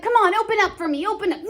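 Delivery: a mock-coaxing father; teacher voicing Montague begging his son, lightly coaxing; pushy father. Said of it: "And he's like".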